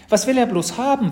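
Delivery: put-on high, loud child's question; puzzled little sister. A narrator's reading. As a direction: wonder aloud like an excited little girl. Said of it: "fragte Mathilda".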